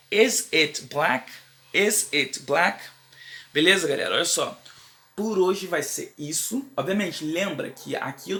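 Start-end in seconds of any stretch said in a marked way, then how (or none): 0.1-2.8 very slow, stress on "Is"; slow question model. Shown as a demonstration. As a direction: model the question word by word for learners. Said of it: "eu vou falar".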